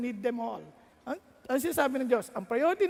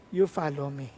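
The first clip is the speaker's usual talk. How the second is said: lightly lower, calm firm answer; voice of God; 8 semitones lower than usual. Acted. God calmly answering the anxious young pastor, a little lower.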